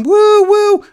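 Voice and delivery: very high, loud woo; mock whoops. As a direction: whoop like at a party. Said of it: "You're gonna be like".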